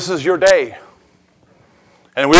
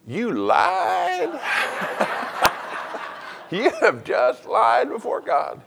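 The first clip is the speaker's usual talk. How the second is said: laughing tease; 4 semitones higher, 9 dB quieter, slower than usual. Real, not acted. Teasing the congregation.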